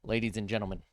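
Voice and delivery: announcer voice. Pretend. Announcer opening.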